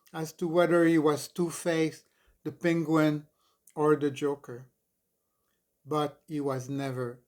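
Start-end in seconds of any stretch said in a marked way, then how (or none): none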